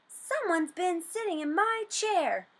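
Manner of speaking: lightly voiced grumbling bear; a hint of a grumpy papa bear. A narrator's reading. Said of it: "grumbled Papa Bear".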